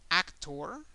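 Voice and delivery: "actor" with the stress on AC, rising at the end; English "actor", AC punched, r sounded; English pronunciation. Shown as a demonstration. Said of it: "in English is actor".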